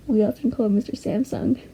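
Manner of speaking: normal talking voice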